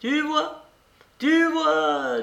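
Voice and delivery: put-on high-pitched, beaming old lady's triumph; gleeful, high exclamation; lively and radiant. A narrator's reading. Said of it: "dit vivement la babouchka toute rayonnante".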